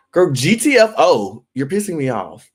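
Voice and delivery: loud, high, stretched-out dismissal; mock telling-off. Host imagining telling off a castmate, exasperated. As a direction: play up being fed up, punching each letter.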